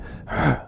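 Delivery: heavy sigh on sitting down; acted sigh of dread; weary sigh. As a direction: let out a fed-up sigh.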